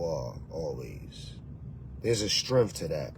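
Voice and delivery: low, unhurried host intro voice, dropping soft at line ends; show host intro. Acted. Host greeting his channel family, low calm intro. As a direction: set the tone like opening your own show.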